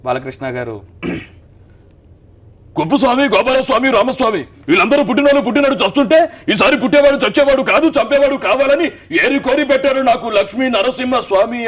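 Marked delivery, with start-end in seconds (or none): 2.8-11.7 much higher, much louder furious heroic roar; Balakrishna faction hero. Retold or imitated. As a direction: thunder the punch dialogue like a Rayalaseema faction hero. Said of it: "ఆ ఆవేశం, వీరత్వం".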